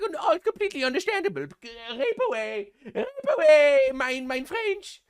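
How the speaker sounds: high, fawning German caricature; hammed-up submissive German voice; meek mock German. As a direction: grovel as a squeaky cartoon German. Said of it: "And they're like".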